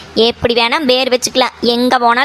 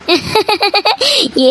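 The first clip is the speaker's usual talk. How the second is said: light, high, rippling laugh; amused laugh; 6 semitones higher than usual. Acted. Laughing at her friend's hotel-or-mess argument, amused laugh. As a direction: laugh it off at a higher pitch.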